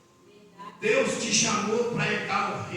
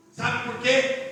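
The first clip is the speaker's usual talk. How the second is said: usual speaking voice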